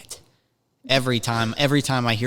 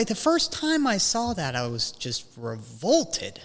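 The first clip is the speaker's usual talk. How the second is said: normal talking voice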